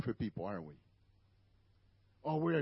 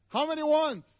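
louder challenge; 12 semitones higher, 7 dB louder than usual. Acted. Preacher quizzing the congregation.

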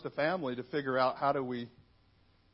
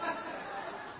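breathless giggle; 10 dB quieter than usual. Real, not acted. Laughing at new surname.

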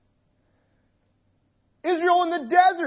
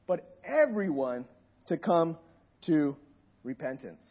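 softer, steady scripture reading; 5 semitones lower, 6 dB quieter than usual. Acted. Preacher reading Second Peter to the congregation, gentle and reverent. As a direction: read it out calmly, letting the last word fade.

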